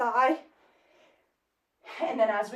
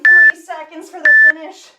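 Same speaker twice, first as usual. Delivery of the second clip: shouted countdown; loud call; 3 semitones higher, 15 dB louder than usual. Real, not acted. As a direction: drive them to the finish. Coach counts to the finish.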